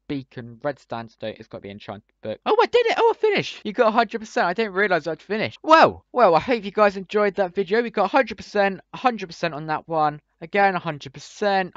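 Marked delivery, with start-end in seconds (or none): 2.5-5.6 excited. Real, not acted. Finishing quiz with full score. 5.6-9.5 excited. Real, not acted. Excited wrap-up after the win.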